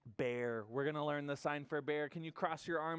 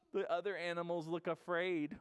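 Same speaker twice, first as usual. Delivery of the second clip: ordinary delivery, nothing marked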